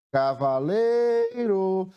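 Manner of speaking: "Cavaleiro" called slowly, LEI held long and high; calling out the word. Shown as a demonstration. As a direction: hail "Cavaleiro", stretching the "lei". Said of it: "Chamando a palavra".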